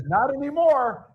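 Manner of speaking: high, punchy comeback; high mock tough-guy reply; players' retort. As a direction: snap it back like a fired-up team. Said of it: "And they said".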